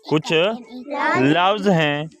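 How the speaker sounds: put-on slow, drawn-out repeat for children; slow teacher's repeat of कुछ लफ्ज़ हैं. Shown as a demonstration. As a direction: stretch each word out slowly so kids follow.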